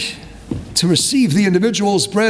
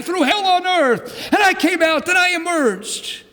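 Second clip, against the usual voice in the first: full-force preaching; 7 semitones higher than usual. Real, not acted. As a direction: preach it out to the whole shul with fire. Preaching climax about survivor.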